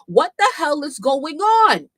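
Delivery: high, loud, drawn-out question; outraged exclamation. Real, not acted. Streamer on bloggers ignoring the lawsuit, outraged exclamation. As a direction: wail it in loud disbelief. Outraged that nobody reports the news.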